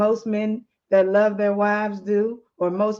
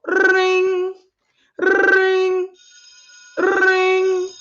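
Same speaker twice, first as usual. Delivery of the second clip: high, loud sing-song ring imitation; phone ringing; 10 semitones higher, 5 dB louder, much slower than usual. Narrator imitates the phone call ringing, high and loud.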